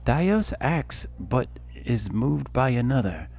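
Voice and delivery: a goddess warning of tragedy; solemn goddess voice. Acted. Helia speaks through the birds.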